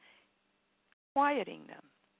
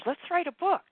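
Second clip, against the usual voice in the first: high, eager little inner voice; a small, excited nudge, pitched up; 10 semitones higher, 6 dB louder than usual. Acted. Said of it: "this little voice".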